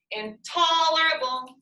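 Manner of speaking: INTOLERABLE with TOL held long and high; stressed syllable stretched. Shown as a demonstration. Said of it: "you stretched out one of those".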